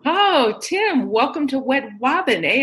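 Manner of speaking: mocking sibling tease, high; teasing. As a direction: rib him about Wed Wobbin. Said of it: "his brothers would tease him".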